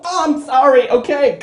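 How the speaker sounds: high, loud, panicked mock apology; cowed underling. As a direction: yelp sorry and scramble to obey. Cowed writer gives in to boss.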